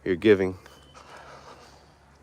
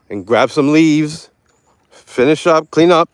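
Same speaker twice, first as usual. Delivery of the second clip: building excitement; 5 semitones higher, 6 dB louder than usual. Pretend. Hyped-up instructions building louder.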